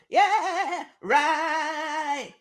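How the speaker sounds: mock high loud "yeah, right"; high shouted yeah. Imitating the man on the track, high and loud. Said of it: "he just goes".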